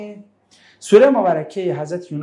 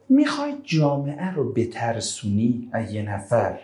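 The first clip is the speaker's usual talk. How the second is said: usual speaking voice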